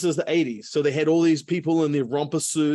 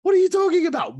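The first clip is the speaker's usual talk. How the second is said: much higher, louder incredulous imitation; mocking disbelief; 4 dB louder, faster than usual. Racers jeer at Cliff's claim he'll win, incredulous. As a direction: squeal it like a jeering kid who can't believe it. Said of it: "they all start laughing, like".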